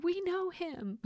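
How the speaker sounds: high, starry-eyed we know him; high, delighted with stress on we; girls quoting Elf. Retold or imitated. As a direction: chime it like giddy fans.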